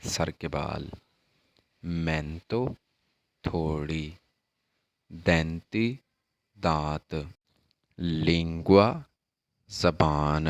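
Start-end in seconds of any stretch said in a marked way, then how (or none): 0.0-8.9 slow teacher model. Shown as a demonstration. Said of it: "اب آگے آتا ہے".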